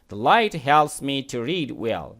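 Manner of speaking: each word read out slowly and clearly; slow model reading. Shown as a demonstration. Teacher reading the sentence slowly to students. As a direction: read it slowly for the class to follow. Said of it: "teacher reads model sentence slowly".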